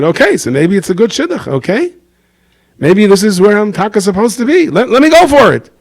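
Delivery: resolved rabbi. Retold or imitated. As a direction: talk yourself into going for it. Rav recalibrates, resolves.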